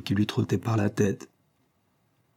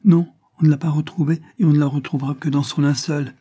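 higher, louder frantic outburst; frantic, pitched-up despair; violently overwrought; 5 semitones higher, 5 dB louder than usual. A narrator's reading. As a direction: cry it out in great agitation. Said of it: "en proie à la plus violente surexcitation".

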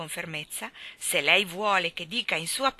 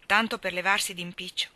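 usual speaking voice

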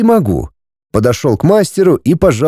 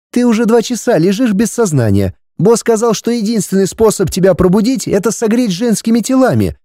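put-on higher young woman's voice; 8 semitones higher than usual. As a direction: speak as the troglodyte girl. Ulrika explains to hero.

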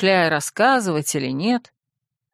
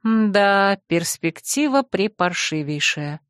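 usual speaking voice

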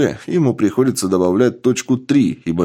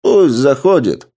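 louder commanding call through the door; 4 semitones higher, 5 dB louder than usual. A narrator's reading. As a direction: call out loudly as the king. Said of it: "громко сказал король".

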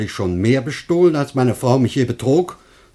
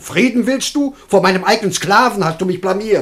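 much higher and louder, indignant; furious outburst; 10 semitones higher, 5 dB louder than usual. Acted. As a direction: explode at the stubborn stranger. Master furious at the stranger.

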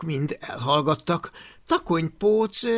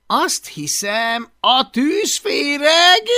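slow, higher and louder gloat; smug slow brag; 11 semitones higher, 9 dB louder, slower than usual. Acted. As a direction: draw out the name proudly. Takonypóc büszkén. (lassú, önelégült kérkedés)

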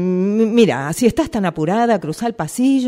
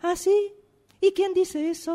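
sarcastic, much higher "ah, sí?"; mock surprise; 12 semitones higher than usual. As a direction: feign astonishment to belittle her. Boss mocks rumour of closure.

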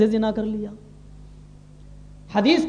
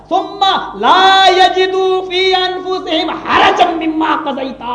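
Quranic recitation; 7 semitones higher, 5 dB louder, much slower than usual. Acted. Preacher recites Quranic verse.

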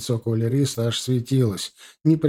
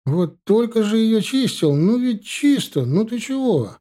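high, swooping protest; protesting young soldier; 10 semitones higher than usual. A narrator's reading. Young soldier insisting his boots are clean, high-pitched protest. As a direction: plead your case in a much higher voice.

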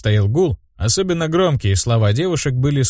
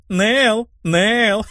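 high sing-out of a name; teasing cries; 12 semitones higher than usual. A narrator's reading. Vilma taunting her friend, high mock calls. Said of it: "закричала Вильма".

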